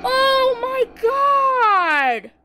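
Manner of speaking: high, loud, drawn-out cry; horrified scream. Real, not acted. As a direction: scream the words slowly in horror. Killer appears in the car.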